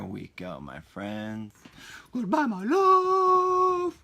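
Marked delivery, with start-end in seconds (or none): 2.1-4.0 very high, slow melodramatic goodbye; hammed-up movie farewell. Farewell to the Wi-Fi, high, slow and melodramatic. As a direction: croon it like a tragic film parting. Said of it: "I feel like that's another movie".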